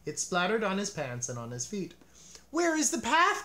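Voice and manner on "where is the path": high, loud, lost and alarmed; a high frightened cry; panicked cry. A narrator's reading. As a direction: Toad cries out, blinded by ice cream. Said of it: "cried Toad".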